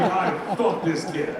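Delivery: normal talking voice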